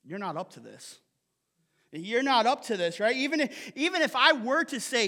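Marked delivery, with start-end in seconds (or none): 0.1-1.0 soft and low. Acted. Jesus's line, said twice.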